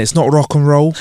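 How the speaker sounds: lightly put-on Mancunian rocker accent. Shown as a demonstration. Co-host showing how Oasis sound, mock Mancunian accent. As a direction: demonstrate a Manchester rocker's accent, understated. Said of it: "that's how they sound it".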